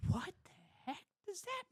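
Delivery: high, incredulous questioning; acted-out squeak of bewilderment; baffled disbelief. Retold bafflement at strange email.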